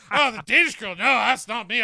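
loud, high mock denial; mock protest. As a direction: protest too loudly, imitating a friend. Imagined friend's loud mock denial.

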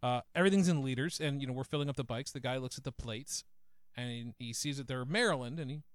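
ordinary talk, nothing marked

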